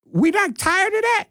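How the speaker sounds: very high-pitched incredulous question; incredulous exasperation. Real, not acted. Host challenging his community about park fights, high exasperated disbelief.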